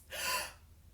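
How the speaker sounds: sharp acted gasp. A narrator's reading. Sasha hearing the prom news, shocked gasp. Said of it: "you hear Sasha gasp".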